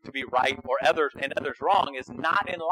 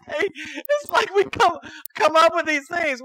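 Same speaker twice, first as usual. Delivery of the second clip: high, slow, breaking into laughter; laughing through words; 10 semitones higher, slower than usual. Real, not acted. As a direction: keep laughing as you say it. Still laughing at theology jargon.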